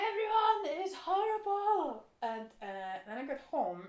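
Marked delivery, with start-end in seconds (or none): 0.1-2.0 loud, high singsong wail; mock crying. Retelling how she cried in the car, wailing. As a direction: wail it out like a hammed-up tantrum. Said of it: "cried in the car".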